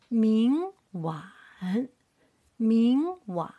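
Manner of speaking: long rise on 明, then dip 晚; second tone, third tone. Shown as a demonstration. Teacher drilling the third line, 明 rising, 晚 dipping. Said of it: "The third line".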